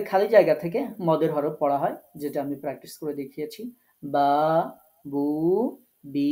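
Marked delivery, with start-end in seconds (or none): 4.0-6.3 বা, বু, বি each with its vowel drawn out; separate, held syllables বা, বু, বি; elongated vowels. Shown as a demonstration. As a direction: stretch the long vowel in বা, বু and বি, one at a time. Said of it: "এটা টেনে পড়তে হবে".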